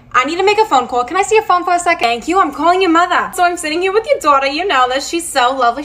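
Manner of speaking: ordinary talk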